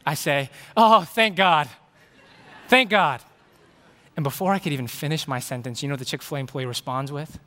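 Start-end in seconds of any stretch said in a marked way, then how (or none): 0.8-3.2 loud, higher burst of relieved excitement; ecstatic relief. Retold or imitated. Customer handed his cup of sweet tea, ecstatic. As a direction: exclaim it like a prayer answered. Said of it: "moment of absolute ecstasy and excitement".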